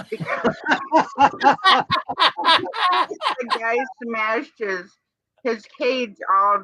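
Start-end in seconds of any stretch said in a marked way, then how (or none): 0.6-3.4 high laugh burst. Real, not acted. Laughing at the video.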